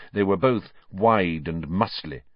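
usual speaking voice

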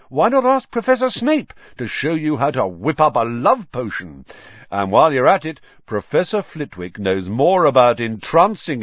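loud, high showman voice; grand preening host. A narrator's reading. Lockhart's showy speech to school.

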